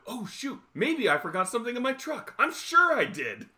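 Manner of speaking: high, exaggerated fake excuse; hammed-up phony realization; feigned forgetfulness. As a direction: act out a transparent pretext.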